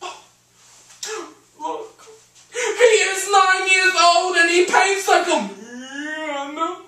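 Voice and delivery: high, drawn-out mother impression; hammed-up proud mother; doting mother's exclamation. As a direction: marvel loudly in a high stretched voice. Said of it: "she just said".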